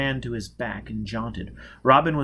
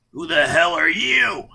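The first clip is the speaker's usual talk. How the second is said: dazed rough voice; 6 semitones higher, 8 dB louder than usual. Acted. Dazed man just out of his trance.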